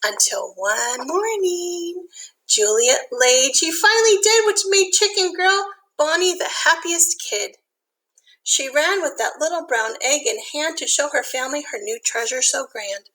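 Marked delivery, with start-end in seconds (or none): none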